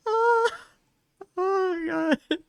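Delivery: high, wailing oh God; crying laugh. Real, not acted. Streamer wailing with laughter at the cat. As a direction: wheeze it out near tears of laughter.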